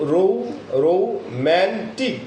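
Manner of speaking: Romantic slow, man pushed forward; stress on MAN. Shown as a demonstration. Teacher showing students the stressed romantic, slow and emphatic. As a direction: draw out romantic, hitting man hard. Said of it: "man শব্দের অর্থে stress থাকার কারণে".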